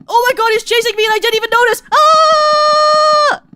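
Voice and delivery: high, fast panicked shout into a scream; startled screaming. Real, not acted. Player realizes something is chasing her, startled screaming. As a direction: shriek as you suddenly see you're being chased.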